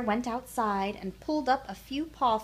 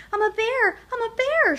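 high, loud cub shout; excited shout; 12 semitones higher, 8 dB louder than usual. A narrator's reading. Little Bear protests to the big wolf, high loud shout. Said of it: "shouted Little Bear".

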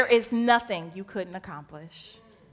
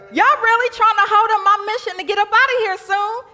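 loud, higher exasperated scolding; fed up with the disciples; exasperated Jesus; 5 semitones higher, 6 dB louder than usual. Acted. Jesus scolds his failing disciples, loud exasperated tone. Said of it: "angrily and says".

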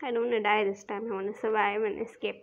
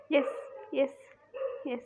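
ordinary talk, nothing marked

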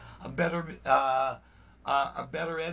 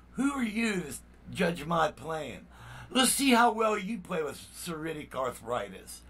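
ordinary talk

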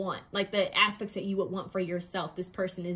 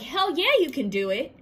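high, loud hype-man cheer; cheering on a doubting friend, high and loud; 5 semitones higher, 3 dB louder than usual. Retold or imitated. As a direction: pump them up, high and loud. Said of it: "They're gonna be like".

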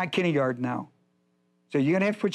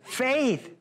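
congregation answer cue; 6 semitones higher than usual. Shown as a demonstration.

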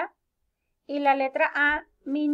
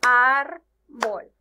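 árbol as ár - bol, loud and slow; árbol split in two, hard loud ár first; syllable by syllable; 8 dB louder than usual. Shown as a demonstration. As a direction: clap out árbol in two beats. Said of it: "árbol tiene dos sílabas".

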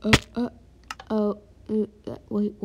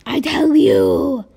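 loud, slow emphatic cry; loud exclamation; 3 semitones higher, 12 dB louder, slower than usual. Real, not acted. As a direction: belt it out slowly.

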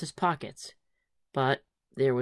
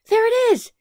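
very high, loud joyful shout; 8 dB louder than usual. Acted. Willis jumping up and down, overjoyed. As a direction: yell it while jumping up and down. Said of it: "shouted Willis".

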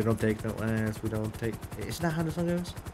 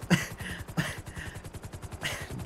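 soft laugh; 3 semitones higher, 5 dB quieter than usual. Real, not acted. Reacts to confusing claim.

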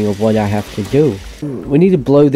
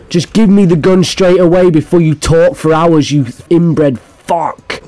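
angry demand; 5 semitones higher, 4 dB louder than usual. Real, not acted. Gamer snapping at talky NPC.

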